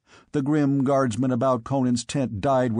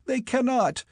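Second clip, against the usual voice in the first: despairing squire; 7 semitones higher than usual. Acted.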